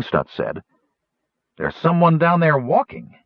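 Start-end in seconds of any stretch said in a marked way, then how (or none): 1.6-3.2 high-pitched, intent lookout call; excited spotting. A narrator's reading. Wingover tells the others what he sees below, excited. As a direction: report a sighting in a high voice.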